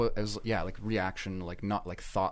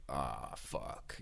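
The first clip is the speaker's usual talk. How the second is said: low, deflated curse under the breath; sinking low groan; 7 semitones lower, 11 dB quieter than usual. Retold or imitated. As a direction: sag as the bad mood hits. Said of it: "the sinking feeling".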